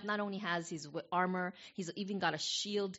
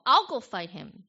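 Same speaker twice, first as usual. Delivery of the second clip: eager boast; 2 semitones higher, 12 dB louder than usual. Acted. David volunteers eagerly to Saul.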